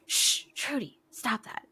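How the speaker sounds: retold mom shushing her cat; hushing a cat. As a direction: lightly imitate hushing a misbehaving pet. Mom hushing cat during phone call.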